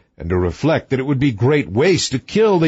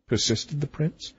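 ordinary delivery, nothing marked

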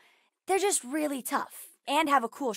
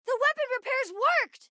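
high, jubilant call; excited announcement; 12 semitones higher, faster than usual. Acted. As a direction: cry out the success with pitch raised. Announces successful repairs in action.

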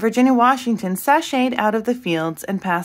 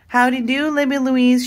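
higher, lilting sing-song call; sing-song greeting; 5 semitones higher, 3 dB louder than usual. A narrator's reading. Neighbour calls a greeting to passing girl, in lilting sing-song. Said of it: "she called in her sing-song voice".

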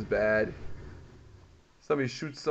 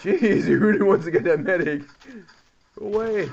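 loud, raised plea at the enemy; exasperated shout; 6 semitones higher, 10 dB louder than usual. Real, not acted. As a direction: shout him off, annoyed. Enemy chasing the medic.

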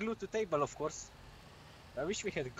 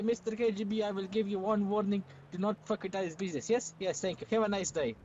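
thick mock Russian accent. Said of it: "I can't say meth in a Russian accent".

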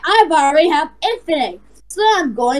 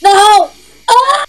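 higher, louder cry of dismay; pretend scream; 5 semitones higher, 5 dB louder than usual. Player crying out in the battle, pretend scream. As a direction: yelp as if under attack.

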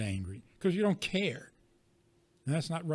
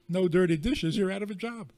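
ordinary talk

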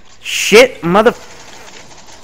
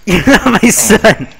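normal talking voice

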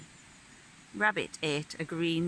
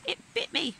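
much higher, hurt exclamation; crying out; 11 semitones higher, faster than usual. A narrator's reading. Hedgehog complaining to rabbit of the snowball, crying out. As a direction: cry it out in high-pitched shock. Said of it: "he cried".

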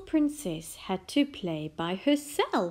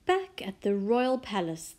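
usual speaking voice

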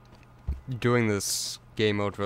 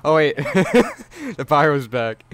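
loud, higher words broken by real laughter; laughing; 5 semitones higher, 8 dB louder, much slower than usual. Real, not acted. Gamer seeing the pyro come back mid-speech, laughing. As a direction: crack up while announcing a surprise.

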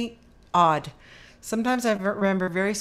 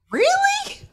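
high-pitched incredulous "really"; retold disbelief. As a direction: squeak it as a doubtful question, acting out the reaction. Retold reaction to suspect crowd estimate.

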